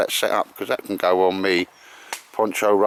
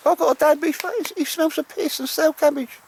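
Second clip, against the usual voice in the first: dog's voice. Pretend. Owner voicing his dog Baldrick to Al, high squeaky dog voice. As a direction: speak for the dog in a squeaky falsetto. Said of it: "He says".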